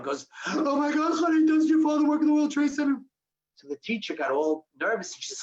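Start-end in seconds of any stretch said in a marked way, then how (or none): 0.5-3.0 high, gushing alarm; hammed-up gasp of teenage concern; overly emotional friend. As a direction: pile on the drama like a teen friend. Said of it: "who's very, very emotional about a hangnail".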